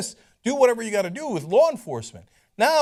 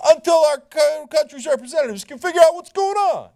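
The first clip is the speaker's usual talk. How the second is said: higher, louder mock read of the press release; sneering quote of the statement, pitched up; mocking readout; 9 semitones higher, 7 dB louder than usual.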